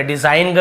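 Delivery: डिजाइन with a "guh" tacked on the end; G wrongly sounded. Shown as a demonstration. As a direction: add an audible G to डिजाइन. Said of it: "ऐसे नहीं पढ़ रहे".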